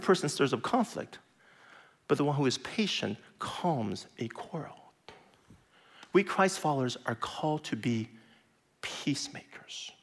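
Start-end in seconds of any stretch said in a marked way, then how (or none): 2.1-4.8 soft unvoiced reading of the verse; whispered proverb. Real, not acted. Quoting proverb softly. 8.8-9.9 slow unvoiced whisper on peacemakers. Real, not acted. Key word dropped to whisper.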